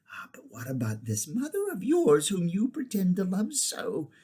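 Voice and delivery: high, whispery witch voice; sly, taunting witch. A narrator's reading. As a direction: needle the boy about his mother.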